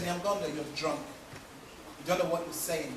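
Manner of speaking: calm him down, low and even; low calm rebuke. Acted. Wife quietly tells her drunk husband he's drunk.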